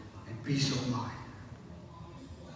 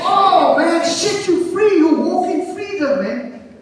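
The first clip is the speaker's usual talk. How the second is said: high, exultant pulpit cry; full-force preaching; 11 semitones higher, 4 dB louder than usual. Real, not acted. Preacher promising congregation the truth frees them, full-force preaching. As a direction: proclaim it out to the crowd.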